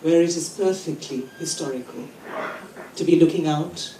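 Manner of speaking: incantatory list, each phrase set apart; poem reading. Acted. Poet reading the title poem about the city to a conference, emphatic recital. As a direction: recite with emphatic openings and deliberate pauses.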